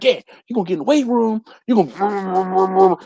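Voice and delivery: high Southern coach imitation dissolving into blabber; hammed-up, excitable Southern coach; animated Southern coach. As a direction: play a worked-up Southern coach, fast then stretched into mumbo-jumbo. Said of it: "all types of animated".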